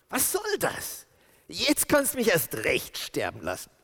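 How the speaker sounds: a high, whining "Was soll das?"; whiny high protest. Acted. Jona whining at God over the shade. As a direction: pitch it up in a petulant whine.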